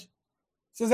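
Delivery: ordinary delivery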